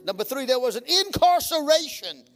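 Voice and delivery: loud, rising preacher's cry; preaching at full force. Acted. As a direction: build it up from the pulpit.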